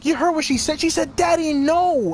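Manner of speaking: high-pitched, outraged retelling; appalled disbelief. Real, not acted. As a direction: relive the plea in shock. Indignant retelling of child's plea.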